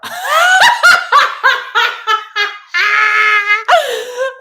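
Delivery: long, loud, high cackling laugh; helpless laughing fit rising to a squeal; uncontrolled laughter. Real, not acted. Streamer reads Anthony's wish, bursts out laughing.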